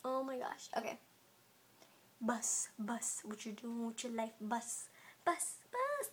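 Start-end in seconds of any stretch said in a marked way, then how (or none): 0.0-4.1 breathy whispered gasp to self. Real, not acted. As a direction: whisper it under your breath. Quiet reaction while playing.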